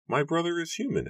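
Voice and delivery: very high, even character voice; Matthew's character voice. A narrator's reading. Matthew repeats in his high voice.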